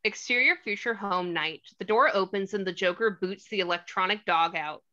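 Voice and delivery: normal talking voice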